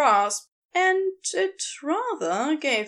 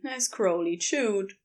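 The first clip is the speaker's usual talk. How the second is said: ordinary delivery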